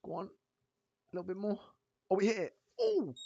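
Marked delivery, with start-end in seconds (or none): 1.1-3.1 high excited cheer; rising thrill as it hits. Real, not acted. Price hitting target live.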